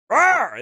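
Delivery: loud playful growl; mock growl. Mocking gruff Scots with a loud growl. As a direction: growl like a gruff yelling guy. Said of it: "They kinda just growl when they talk".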